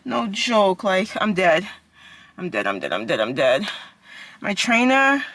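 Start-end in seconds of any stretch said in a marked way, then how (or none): 2.4-3.7 exhausted. Real, not acted. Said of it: "I can't even talk".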